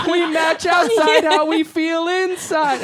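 high, loud and slow, overjoyed; gushing joy. Retold or imitated. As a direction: proclaim it like a heartfelt revelation. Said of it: "in unison they go".

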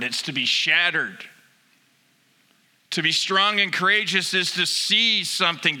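ordinary delivery

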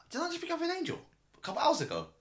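surprised. Retold or imitated. Driver retelling his surprise to passenger.

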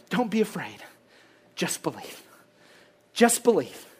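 a near whisper of comfort; hushed reassurance. Acted. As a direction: let it fall to a murmur. Repeating God's comfort.

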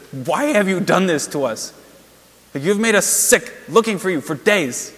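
acted indignation, hitting the key words hard; mad and confused. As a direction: act a fed-up mother demanding answers. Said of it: "No, she's mad and confused".

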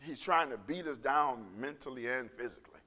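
low, earnest pastoral prayer voice. Acted. Pastor praying for the congregation, earnest and low. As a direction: pray over the congregation, unhurried.

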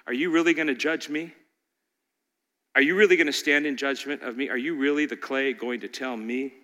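God's reply. Retold or imitated. God gently rebuking the questioning clay. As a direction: answer like God, quiet and firm. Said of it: "And God simply says".

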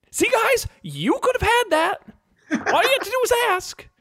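high, mocking scold; scolding genie. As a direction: lecture them like a fed-up genie.

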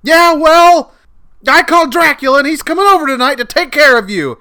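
loud high shouted threat; shouting. Acted. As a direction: yell the threat.

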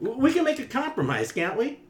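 high, stammering pleading writer; nervous. Acted.

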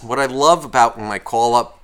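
ordinary delivery

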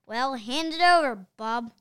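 loud, commanding call; boy ordering the hypnotized principal; cried out. A narrator's reading. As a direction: bark the order at the entranced principal.

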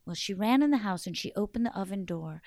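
ordinary delivery, nothing marked

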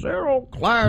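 put-on high, creaky old geezer; old man voice. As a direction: grumble like a cranky old man. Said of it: "let me say that in my old man voice".

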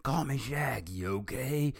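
loud, high barked demand. Acted. Rogers barking back at the doctor, loud and high. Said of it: "Rogers barked back".

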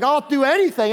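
mock-eager beggar pleading. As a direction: blurt it out, desperate to follow. Eager beggar voiced in sermon.

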